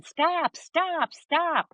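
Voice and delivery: high-pitched retold plea to stop; three high, imitated cries; helpless pleading. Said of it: "I just stood there going".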